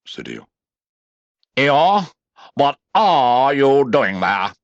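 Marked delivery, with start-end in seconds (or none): 1.6-4.5 higher, louder, slow demand; Rabbit's voice. A narrator's reading. Rabbit questioning Eeyore, slow demanding voice. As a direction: demand an answer. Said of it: "said Rabbit".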